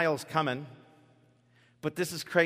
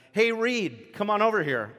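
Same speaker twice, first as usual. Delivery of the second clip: teasing call; 6 semitones higher, 5 dB louder than usual. Pretend. Jesus teasingly calling Simon.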